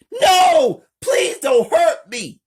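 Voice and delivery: terrified high shouted plea; loud, panicked, very high cry; scared. A narrator's reading. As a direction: beg for your life at full volume. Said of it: "Amber, scared".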